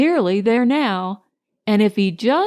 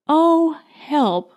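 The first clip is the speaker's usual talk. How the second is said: high wailing oh, then help; a bear stuck in a gorse bush wails for help; plaintive cry for help; 4 semitones higher than usual. A narrator's reading. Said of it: "liking honey so much".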